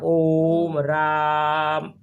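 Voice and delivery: ओम राम with each vowel stretched very slowly; pluta vowels held long. Shown as a demonstration. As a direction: hold the o of ओम and the aa of राम far too long. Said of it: "इसके तरीका है ना". (ओम राम बहुत धीरे, स्वर खींचकर)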